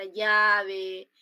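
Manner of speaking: llave stretched out very slowly and loudly; slow demo word. Shown as a demonstration. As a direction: draw out llave so every sound is heard. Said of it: "así se representa en la fonología".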